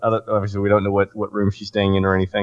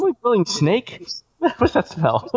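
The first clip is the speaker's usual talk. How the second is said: hammy sniffing voice; 12 semitones higher, slower than usual. Pretend. Joking about cooking lizard.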